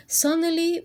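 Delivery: spring the surprise on the listener; dramatic suddenly. A narrator's reading. Narrator springing a surprise on the children.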